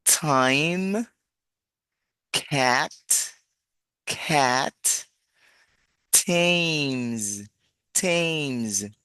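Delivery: "Time, cat, cat, Thames, Thames" spaced out with crisp T; teacher's stretched model words "Time, cat, Thames"; articulated T sound. Shown as a demonstration. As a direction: release each T clearly in "Time, cat, Thames". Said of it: "con esa misma articulación".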